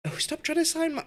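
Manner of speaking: high-pitched exasperated outburst; exasperated yelp at the screen. Real, not acted. Rival club bids for his players.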